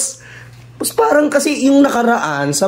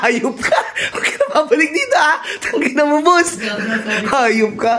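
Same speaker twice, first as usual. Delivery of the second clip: laughing scolding; 4 semitones higher than usual. Acted. Chasing the intruder out.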